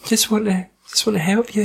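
a hint of a sugary teacher; pally-pally teacher. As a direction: sweet-talk her like a fake-friendly teacher. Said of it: "super pally-pally".

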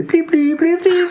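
high electronic bleeps, climbing; imitated bleeping. Mimicking film gadgets being fiddled with, bleeping.

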